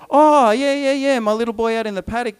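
loud, high "oh" tumbling into quick words; acted dawning realization; sudden recollection. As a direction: blurt it as if just remembering, then ease down. Jesse suddenly remembers his youngest son.

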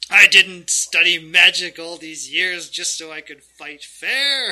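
loud outburst rising on the last word; indignant villain. Acted. Villain scoffing at the fighter's fair fight, indignant. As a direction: blast the suggestion with theatrical outrage.

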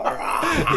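high mock tiger rah; tiger roar. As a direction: imitate a toy tiger roar. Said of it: "the tiger and go".

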